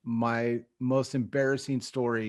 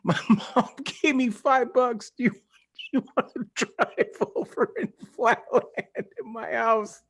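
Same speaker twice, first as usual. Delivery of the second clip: laughing mimic of kid self; 10 semitones higher than usual. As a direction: imitate your awkward teenage self, giggling. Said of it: "Little fourteen-year-old me was like".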